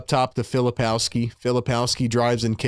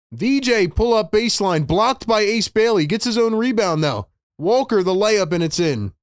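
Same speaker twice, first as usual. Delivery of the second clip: brisk, raised play-by-play call; live play-by-play; 9 semitones higher, 4 dB louder than usual. Acted. Fan streamer calling the Sixers game for chat, play-by-play. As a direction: call the action like a broadcaster.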